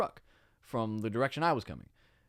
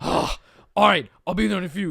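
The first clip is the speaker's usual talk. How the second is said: put-on louder, exasperated German-accented dad; raised, German-accented reluctant reply; 6 semitones higher, 9 dB louder than usual. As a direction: imitate a German father grudgingly agreeing. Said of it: "He's got this crazy German accent".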